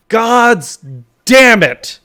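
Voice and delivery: loud, high offstage curse; shouted curse. Acted. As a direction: yell it from behind the door. Distant shout behind the door.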